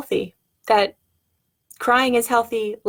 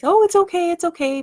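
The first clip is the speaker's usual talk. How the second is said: high, quick-to-comfort "oh, it's okay"; high-pitched parental reassurance; hurried soothing; 8 semitones higher, 4 dB louder than usual. Retold or imitated. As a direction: shush a crying child in a higher voice, starting loud. Soothing a crying child, acted.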